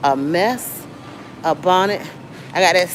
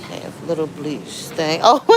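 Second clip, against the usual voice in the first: ordinary talk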